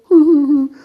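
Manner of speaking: loud, high fake wail; mock sobbing. Human upset on a typical day, loud mock sobs. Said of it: "I'm heartbroken".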